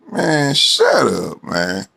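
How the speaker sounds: ordinary talk, nothing marked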